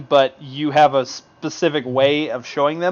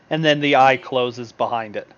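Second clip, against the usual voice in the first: usual speaking voice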